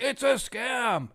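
high, drawn-out mimicry of doubters; mocking, slow and high-pitched; mock outcry. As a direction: imitate naysayers in a high, stretched whine. Mimics online critics crying scam.

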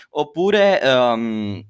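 ordinary delivery

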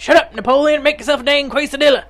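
high, loud, bossy snap; grandma in Napoleon Dynamite. Acted. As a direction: scold like a fed-up movie grandma. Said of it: "be like the grandma in Napoleon Dynamite".

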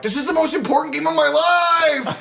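over-the-top shrieking coach; frantic coach. Retold or imitated. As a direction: ham up a panicking coach yelling. Coach meltdown acted as shout.

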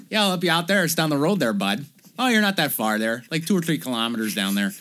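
mock Canadian small-town accent. Local man giving directions to the sorority house, Canadian accent. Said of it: "The most Canadian man in that movie".

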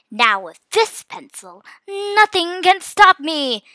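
slow gloating, rising to loud; villainous boast. A narrator's reading. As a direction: draw it out like a triumphant villain. Villain raccoon boasting, overheard.

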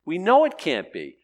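high, emphatic ironic protest; mock objection. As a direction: feign certainty, building to a loud 'right?'. Ironically voices objection to one way.

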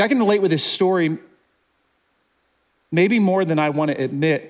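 ordinary delivery

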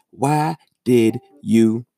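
ordinary talk

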